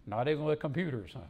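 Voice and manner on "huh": ordinary talk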